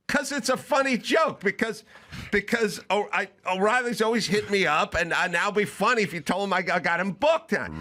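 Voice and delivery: high, strained retold justification; exasperated explaining. Comic justifies the O'Reilly joke to his friend, exasperated.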